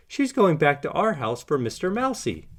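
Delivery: a touch of excited discovery; excited exclaim. A narrator's reading. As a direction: blurt it out like you've cracked the case. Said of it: "Rolly exclaims".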